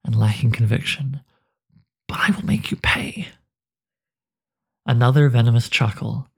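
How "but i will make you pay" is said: soft, breathy vow of revenge; threatening whisper. Acted. Anduin vows vengeance on the Hooded One, hushed menacing whisper. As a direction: whisper the threat through clenched rage.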